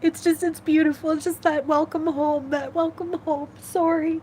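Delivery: high tear-choked voice; crying. Real, not acted. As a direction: speak through the tears. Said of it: "without crying".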